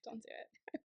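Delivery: real whisper, barely voiced; whispered plea. Real, not acted. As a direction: whisper it, trying to hold off tears. Pleads not to start crying.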